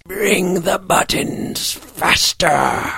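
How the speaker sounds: put-on high, slow, hoarse old-creature voice; hoarse elderly voice. A narrator's reading. Mysterious voice demands buttons from behind the barn door, hoarse elderly shout. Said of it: "they heard a hoarse, elderly voice".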